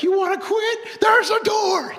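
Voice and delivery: loud high barking coach yell; coach's shout. Retold or imitated. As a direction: bark it at a quitting team. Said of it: "the coach is like".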